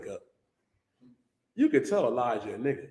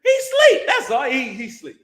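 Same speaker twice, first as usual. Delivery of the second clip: mocking; 9 semitones higher, 7 dB louder than usual. Mocking sleeping god.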